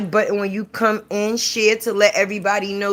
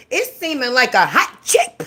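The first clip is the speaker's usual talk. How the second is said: ordinary talk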